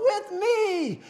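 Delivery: high mock crowd yell; hammed-up call-back; imitated crowd response. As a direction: answer as a mass of eager fans. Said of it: "And they go".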